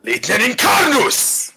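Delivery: high, slow battle-spell yell; shouted incantation. Acted. Player casting Thunderwave with invented Latin, shouted incantation. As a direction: shout it like a spell cast in anger. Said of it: "I, I, I will shout".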